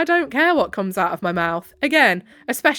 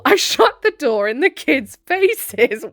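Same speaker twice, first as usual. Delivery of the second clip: breaking into a laugh while reading; slow, higher, amused delivery through laughter; 4 semitones higher, 4 dB louder than usual. Real, not acted. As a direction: read it through a real laugh.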